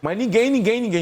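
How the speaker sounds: "ninguém" repeated high and insistent; emphatic repetition. Real, not acted. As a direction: drive the point home, nobody at all. Insisting again on nobody.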